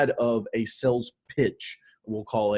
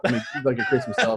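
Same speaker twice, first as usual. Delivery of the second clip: laughing while talking; 11 semitones higher, faster than usual. Real, not acted. Laughing about his girlfriend.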